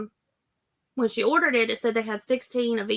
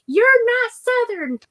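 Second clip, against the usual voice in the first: high, loud mock protest; mock indignant family; 11 semitones higher, 6 dB louder than usual. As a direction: squeal it like scandalized in-laws. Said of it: "they're like".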